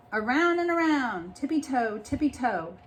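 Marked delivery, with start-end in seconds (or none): none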